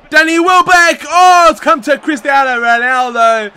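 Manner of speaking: high, loud call of the attack; excited shout. Real, not acted. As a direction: shout the player's name as he breaks. Chance in the match.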